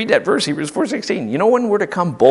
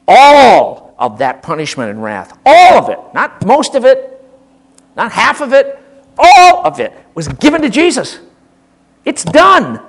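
louder, higher, building emphasis; emphatic preaching; 4 semitones higher, 8 dB louder than usual. Real, not acted. Preacher to congregation, building to a loud emphatic climax. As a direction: drive it home with rising force.

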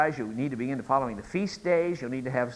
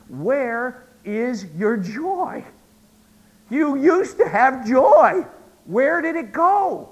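impassioned plea; 10 semitones higher, 12 dB louder, much slower than usual. Acted. Preacher voices Paul's pleading question.

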